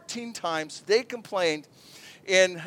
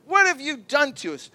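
higher, whiny accusation; sarcastic complaint; 5 semitones higher than usual. Retold or imitated. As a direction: whine the blame at Moses. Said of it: "That's sarcasm".

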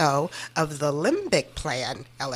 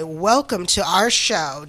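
ordinary talk

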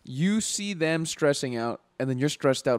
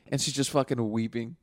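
ordinary talk

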